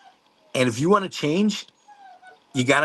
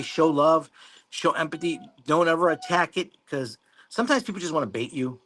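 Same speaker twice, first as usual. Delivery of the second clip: ordinary delivery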